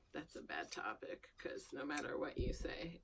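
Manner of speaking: whisper. Real, not acted. Whispering about a touchy topic.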